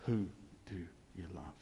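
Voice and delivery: very soft, low, spaced-out question; hushed divine answer. Retold or imitated. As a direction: let it sink almost to silence. God's answer, retold.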